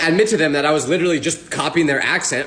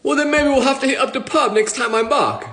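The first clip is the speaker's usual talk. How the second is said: higher, put-on British accent; fake British accent; 5 semitones higher than usual. Selling the fake to the stranger in a British accent. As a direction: sell it hard in a British accent. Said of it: "I was really trying to sell it".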